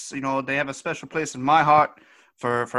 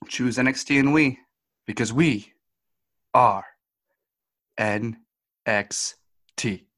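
slow, spaced-out catchphrase, one letter at a time; slogan sign-off; 2 semitones lower, slower than usual. Acted. Host closes the show with the catchphrase, spaced and deliberate. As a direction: drop the show slogan with a pause before each letter. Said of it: "as we always say".

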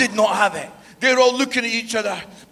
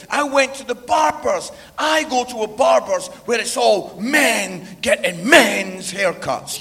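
ordinary delivery

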